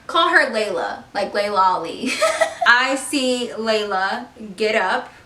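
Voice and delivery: ordinary delivery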